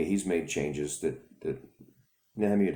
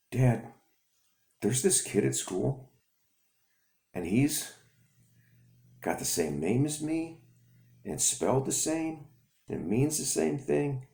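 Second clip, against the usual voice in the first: put-on higher, eager child telling his father; excited kid voice; 9 semitones higher than usual. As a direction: speak up like an excited little boy.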